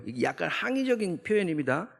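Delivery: ordinary delivery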